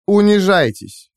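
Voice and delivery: high, slow command; haughty, drawn-out demand; imperious order. A narrator's reading. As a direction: order them to grovel, savouring it. AI orders captives to grovel.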